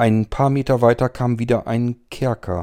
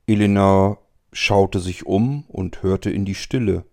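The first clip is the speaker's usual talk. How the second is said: ordinary delivery, nothing marked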